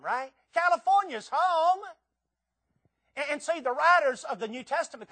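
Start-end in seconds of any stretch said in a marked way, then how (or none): 0.5-1.8 loud, high, slow proclamation; proud declaration. Acted. Preacher answering for Californians asked about home, proud declaration.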